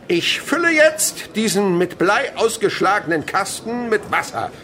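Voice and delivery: high, loud, with a laugh in it; calling out. Acted. Chemist calling out to the class, loud and laughing. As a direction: call it out like a showman. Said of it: "ruft der Chemiker".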